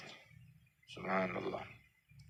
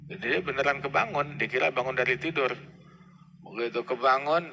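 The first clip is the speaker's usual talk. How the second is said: ordinary talk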